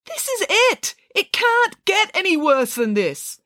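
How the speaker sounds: high, drawn-out despairing cry; anguished outburst. Acted. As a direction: cry out at breaking point. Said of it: "his voice cracking with emotion".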